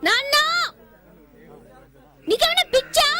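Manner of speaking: outraged shout. Acted. Daughter shouts at her father.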